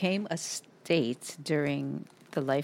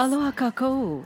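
excited; 6 semitones higher, 3 dB louder than usual. Pretend. Playful Hawaiian greeting.

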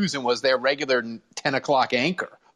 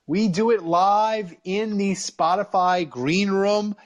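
host show opener; 8 semitones higher than usual. Acted.

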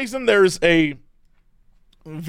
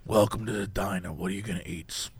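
hushed, low diner waitress role play; whispered asmr role play; 6 semitones lower, 5 dB quieter than usual. Pretend. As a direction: murmur it softly like a waitress. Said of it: "it's like a diner".

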